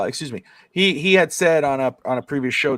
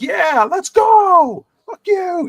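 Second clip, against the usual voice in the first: high, loud drawn-out hype shout; pumped-up mock battle cry; John Cena's hype yell; 6 dB louder, much slower than usual. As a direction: imitate a wrestler hollering, stretched way up high. Acts out John Cena hyping up.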